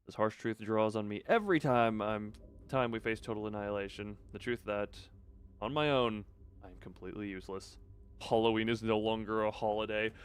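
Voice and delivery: low, sombre story reading; subdued narration. Acted. Narrator facing own uselessness, low subdued reading. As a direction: read it as a downcast inner monologue. Reads the narrator's inner monologue.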